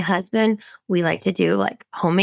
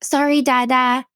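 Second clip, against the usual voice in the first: put-on higher, sing-out toddler voice; mock little-kid sweetness, pitched up; little daughter; 6 semitones higher than usual. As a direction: imitate a small child apologizing to Daddy. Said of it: "and then she's like".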